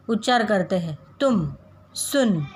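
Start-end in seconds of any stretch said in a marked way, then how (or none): 1.2-2.5 "तुम", "सुन" में छोटा, धीमा उ; उ को हल्का और छोटा रखें; short u demo. Shown as a demonstration. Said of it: "कम स्वर में, धीमे स्वर में". (छोटे उ का धीमा उच्चारण)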